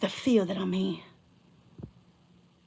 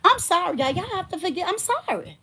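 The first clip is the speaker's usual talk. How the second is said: apologetic; 8 semitones higher than usual. Real, not acted. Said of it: "But I'm mad".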